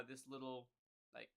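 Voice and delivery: ordinary talk, nothing marked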